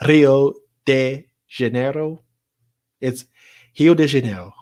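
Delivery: Rio de Janeiro as a Carioca says it, R like H, unrolled; Brazilian Portuguese accent. Shown as a demonstration. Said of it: "how would I say that?".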